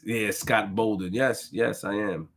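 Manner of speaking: normal talking voice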